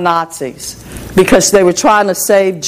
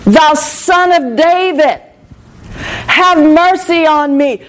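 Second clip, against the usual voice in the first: drawn-out, higher imitated plea; retold cry, stretched rather than shouted; Bartimaeus crying out; 4 semitones higher, slower than usual. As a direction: act out a beggar's long call for mercy. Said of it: "Bartimaeus began to cry out".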